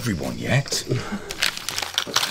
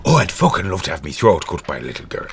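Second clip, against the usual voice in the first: put-on Irish accent; 6 semitones lower, 3 dB quieter than usual. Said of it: "My Irish accent's".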